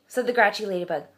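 plain reading voice

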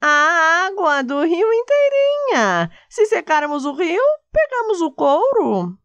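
squeaky dog laying out plan. Acted.

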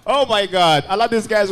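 usual speaking voice

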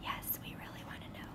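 fast hushed whisper; teacher whispering for the class, fast and hushed; whispered answer. Acted. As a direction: whisper it like an eager secret.

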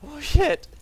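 high, loud yelp of alarm; startled exclamation. Real, not acted. Player barely escaping, crying out in alarm. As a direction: blurt it out in shock.

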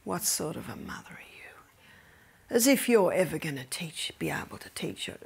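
hissed whisper of the accuser; accuser's whisper. Retold or imitated. Accuser whispering condemnation to the mother, hushed whisper. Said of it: "the accuser of the brethren starts".

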